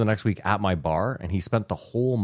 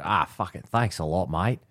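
put-on Australian accent. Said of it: "So he's clearly Australian".

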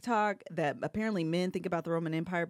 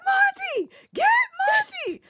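yelling; 10 dB louder than usual. Retold or imitated. Friend yells to grab the dog, urgent high yelling. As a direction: imitate a panicked yell across the yard. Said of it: "Kev is yelling at me".

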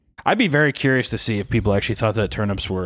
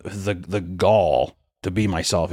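slow hesitant; 5 semitones lower, much slower than usual. Real, not acted.

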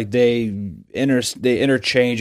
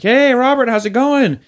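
very high, bright greeting; cheery host; 14 semitones higher, 6 dB louder than usual. Retold or imitated. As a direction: chirp like a TV host.

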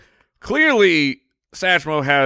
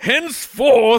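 pompous; 7 semitones higher, slower than usual. Acted. Mocking the odd word.

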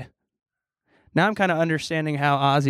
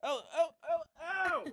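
startled yelp; 13 semitones higher, 10 dB quieter than usual. Real, not acted. Hair pulled painfully.

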